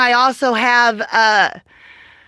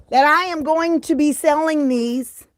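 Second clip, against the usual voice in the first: normal talking voice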